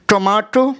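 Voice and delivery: टोमाटो slowly, with a long open "ah"; drawn-out British tomato. Shown as a demonstration. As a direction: stretch the "ah" in टोमाटो. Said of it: "अ का sound करते हैं".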